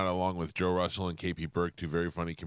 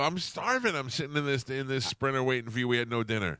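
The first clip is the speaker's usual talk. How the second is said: whiny complaint; 7 semitones higher than usual. Retold or imitated. Retold complaint to Bobby.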